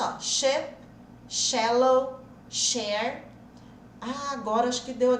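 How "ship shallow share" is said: ship, shallow, share each with an English sh; sh words demo. Shown as a demonstration. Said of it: "Foi mais ou menos isso". (demonstração com sh)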